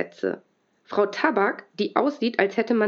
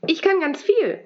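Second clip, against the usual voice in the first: eager call; 8 semitones higher than usual. A narrator's reading. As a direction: blurt it out proudly. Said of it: "ruft Pippa". (eifrig gerufen)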